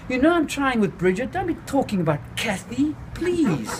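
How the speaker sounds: high, pleading voice. A narrator's reading. The narrator begs Vic not to mention Kathy, high.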